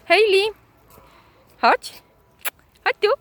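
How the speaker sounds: high, loud call to the dog. Real, not acted. Calling the dog. (wołanie psa wysokim głosem)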